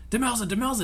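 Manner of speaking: a high, dramatic call for his wife. Retold or imitated. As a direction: cry out her name like a tragic hero. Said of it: "they're playing it super dramatic".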